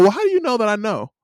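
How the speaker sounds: high-pitched, incredulous comeback; mock-incredulous, high retort; retold challenge.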